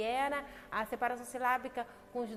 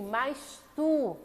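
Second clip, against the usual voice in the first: forceful preaching; 3 semitones higher, 10 dB louder than usual. Acted. Preacher proclaims Christ's command.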